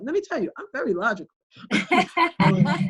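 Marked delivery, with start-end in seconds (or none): 1.6-2.3 a high burst of real laughter. Real, not acted. Mother laughing at her own claim to be logical, laughing. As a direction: laugh out loud at yourself.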